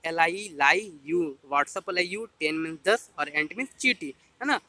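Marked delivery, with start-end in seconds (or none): 0.1-1.4 एल आई ई spelled slowly, then लाई यू said as one word; slow mnemonic spelling. Shown as a demonstration. Teacher showing a memory trick, slow chant. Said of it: "तो मैंने क्या बनाया है कि लाई".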